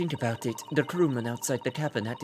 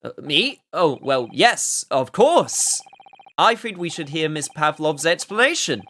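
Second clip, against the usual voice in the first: loud, flustered stammer; 3 semitones higher, 7 dB louder than usual. Acted. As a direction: caught off guard, bluster through. Flustered detective put on spot.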